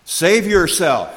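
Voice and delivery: scoffing taunt. Acted. Leaders scoffing at Jesus on the cross, loud taunt. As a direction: sneer it out like a mocking leader. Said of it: "the leaders scoffed".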